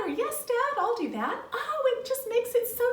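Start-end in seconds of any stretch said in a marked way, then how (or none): 0.1-1.4 high, eager agreement; chirpy, obliging yes; good attitude. Acted. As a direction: act out a cheerfully willing kid. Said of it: "when you have a good attitude".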